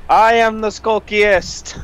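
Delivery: mock boast. Boasting in jest.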